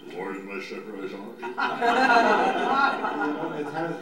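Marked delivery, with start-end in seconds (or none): 0.1-1.4 low, soft, rote mumble of scripture; lifeless recitation. Shown as a demonstration. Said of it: "it doesn't become, it doesn't come alive". 1.4-3.1 loud, high burst of laughter. Real, not acted. Laughs after mock lifeless recitation.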